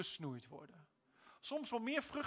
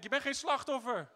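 usual speaking voice

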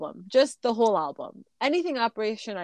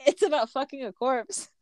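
laughing through it; 12 semitones higher, 5 dB louder than usual. Real, not acted. Laughing at the song's theme.

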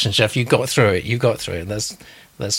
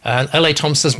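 ordinary talk

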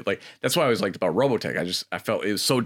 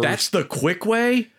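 much higher, slow disbelief; incredulous; 11 semitones higher, much slower than usual. Real, not acted. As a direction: stretch out the disbelief. Incredulous reply to friend.

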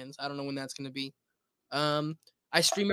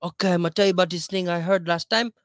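badly faked Latin radio accent, loud; 2 semitones higher, 6 dB louder than usual. Shown as a demonstration. Auditioning for a Latin radio station, fake Latin accent. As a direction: put on a hammy Latin DJ accent. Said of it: "Give me a Latin".

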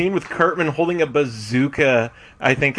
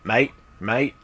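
put-on Australian accent; 2 semitones lower than usual. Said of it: "talk in an Australian accent".

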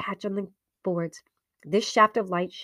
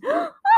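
sudden high gasp of fright; sharp frightened gasp; 7 dB louder than usual. Acted. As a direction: gasp in shock. Door slams, fright.

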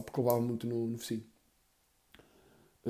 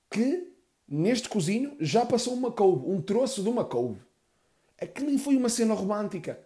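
indignant outburst; 7 semitones higher than usual. Real, not acted. Outraged comic rant.

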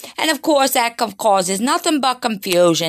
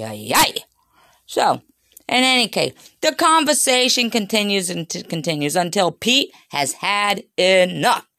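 ordinary delivery

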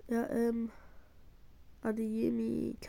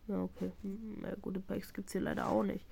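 ordinary talk

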